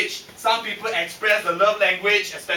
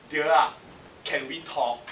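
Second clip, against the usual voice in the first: soft, lower, tender request; gentle wifely plea; 5 semitones lower, 5 dB quieter than usual. Retold or imitated. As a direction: imitate a wife carefully opening a talk. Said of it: "she'll come up and say, hold my hands".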